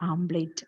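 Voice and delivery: omelette turned into AAM-plate; common mispronunciation. Shown as a demonstration. Teacher showing what we call it, omelette as AAM-plate. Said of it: "We call it as".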